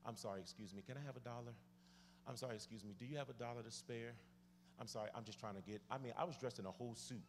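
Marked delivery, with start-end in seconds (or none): 0.1-4.1 soft, low, apologetic plea; polite begging. Retold or imitated. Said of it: "let me say it nicely". 4.8-5.8 soft, low, hurried plea; apologetic begging. Retold or imitated. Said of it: "had to stand at the subway and say".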